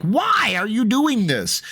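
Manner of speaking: high, drawn-out mock wail; slow, high indignant outcry; exasperated protest. Protest at being hit, retold.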